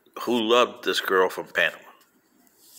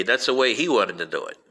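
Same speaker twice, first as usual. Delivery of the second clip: ordinary talk, nothing marked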